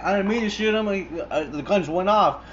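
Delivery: high, loud defensive protest; panicked excuse. Retold or imitated. Shooter protests to his partner: high panicked excuse. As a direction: plead it high, caught off guard.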